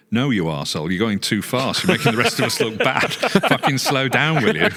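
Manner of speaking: mock angry yell, slowing down at the end; laughing mock foreman rant. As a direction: ham up the foreman's outrage while cracking up. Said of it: "And he goes".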